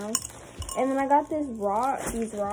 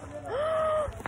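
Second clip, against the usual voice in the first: gasp. Real, not acted. Surprised by something in store.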